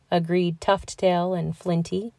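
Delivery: ordinary delivery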